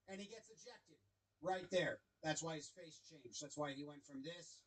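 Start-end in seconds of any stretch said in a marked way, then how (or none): none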